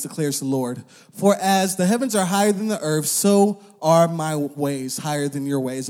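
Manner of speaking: preaching the verse with weight; scripture reading. Acted. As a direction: proclaim the Lord's words to the congregation. Quotes Isaiah scripture.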